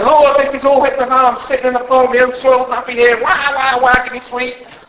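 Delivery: put-on whiny toddler prayer. Mocking a whining believer.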